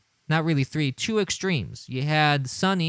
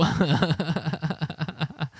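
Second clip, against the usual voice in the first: chuckle; 4 dB quieter than usual. Real, not acted. Laughing at Fredo.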